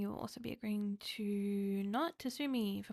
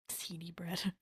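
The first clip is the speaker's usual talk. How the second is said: slow whisper through laughter; 4 semitones lower, 5 dB louder, much slower than usual. Shown as a demonstration. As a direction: breathe it out while laughing. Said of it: "A very seedy bread".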